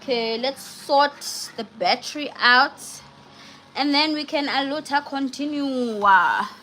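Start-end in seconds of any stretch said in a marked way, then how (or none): none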